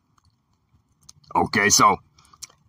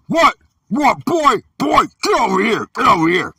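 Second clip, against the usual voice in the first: much higher, outraged mock yell; angry stepfather; 10 semitones higher than usual. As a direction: bellow at the boy in shocked fury. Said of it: "this dude was like".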